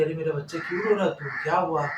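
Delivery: a hint of a mother soothing her crying son; mother's tender worry. Ailing mother asks her weeping son what happened, lightly voiced tender worry. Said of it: "तो माँ बोली".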